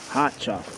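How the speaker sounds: "Hot" stressed before "chocolate". Shown as a demonstration. Vlogger sipping in the woods, hitting "Hot" softly. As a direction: lean on the word "Hot". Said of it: "Emphasis on the word hot".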